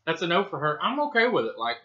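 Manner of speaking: talking while biting chip; chewing crunchy chip; mouth full of chip. Real, not acted. Seen 4: Man holds chip at his mouth, biting, throughout the words.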